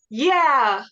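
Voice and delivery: loud whooping yeah; yell. Acted. Cheering on a friend after the kill, loud yell. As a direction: shout it round the corner in triumph. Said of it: "free action to yell".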